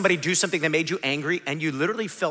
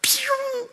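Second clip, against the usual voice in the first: a sudden squeaky "Pew!" jumping far higher. Retold or imitated. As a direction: pop the "Pew!" out in a sharp high squeak like a voice cracking up. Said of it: "all of a sudden your voice goes".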